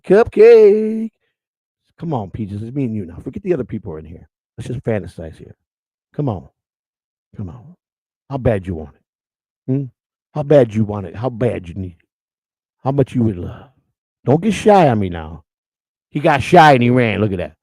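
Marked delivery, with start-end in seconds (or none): 0.0-1.1 high, loud sing-song call; quoting Deadpool. Retold or imitated. Quoting Deadpool calling Cupcake, high loud sing-song. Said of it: "Deadpool said". 2.0-15.4 mock seduction. Seducing Peaches on mic.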